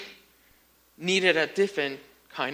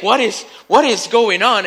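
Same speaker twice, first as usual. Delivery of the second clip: louder, higher startled question; startled bewilderment; 4 semitones higher, 7 dB louder than usual. Acted. Listener startled as the roof falls in, bewildered. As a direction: act out being taken aback.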